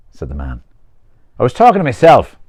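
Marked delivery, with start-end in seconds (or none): 1.4-2.4 loud, raised protest; defensive retort. Acted. Peter explaining himself to the stranger, loud defensive retort. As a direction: insist on it, voice up.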